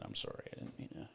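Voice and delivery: very soft, near-whispered apology; hushed, hurried sorry; quiet retold apology. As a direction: mumble a quick apology under your breath. Retold apology to offended woman.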